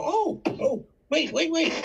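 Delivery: high, eager call. Retold or imitated. Panhandler calling back the rich couple, high and eager. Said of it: "So I go".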